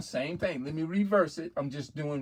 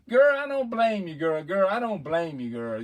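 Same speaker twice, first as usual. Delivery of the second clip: put-on louder, raised girl-to-girl voice; cheering girlfriend; 4 semitones higher, 6 dB louder than usual. Woman egging on her girlfriend, hammed-up approval. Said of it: "Just like if a woman come out and say".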